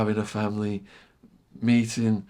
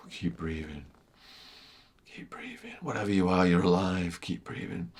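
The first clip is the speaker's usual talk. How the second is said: hushed self-talk; 6 dB quieter than usual. Retold or imitated. Tripping, talking himself through breathing.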